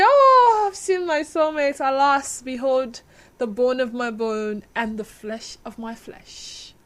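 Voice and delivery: hammed-up lover's vow, fading to hushed reverence; mock romantic declaration. Acts out his soulmate declaration.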